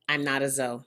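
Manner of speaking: ordinary talk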